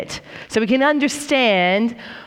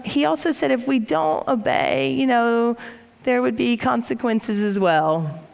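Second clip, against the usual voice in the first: ordinary delivery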